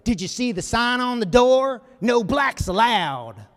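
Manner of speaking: loud jeering, swear words bleeped out as beep. Retold or imitated. Rickey playing a bigot to test Jackie, loud jeering. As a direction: bait him like a heckler spitting insults. Rickey acts out racist abuse.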